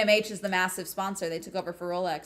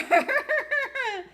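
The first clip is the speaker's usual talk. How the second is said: high laugh; 3 dB louder than usual. Real, not acted. Laughing at race result.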